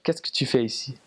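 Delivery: neat, proper France French accent. Shown as a demonstration. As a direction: demonstrate textbook French of France. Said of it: "Very, very proper".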